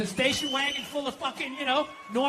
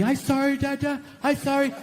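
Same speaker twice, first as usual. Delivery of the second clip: put-on small child's apology, pitched up; little daughter; 2 semitones higher than usual. Young daughter apologises to her dad, imitated little-kid voice.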